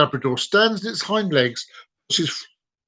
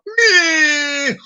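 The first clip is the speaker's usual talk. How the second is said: long, high, loud comic bawl; mock crying wail; 13 semitones higher, 5 dB louder than usual. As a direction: burst into a drawn-out fake wail. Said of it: "begins to cry".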